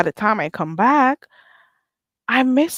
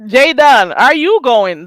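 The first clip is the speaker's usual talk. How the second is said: loud call-out; 4 semitones higher, 9 dB louder, slower than usual. Acted. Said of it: "That's the real question".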